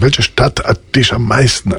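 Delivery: ordinary talk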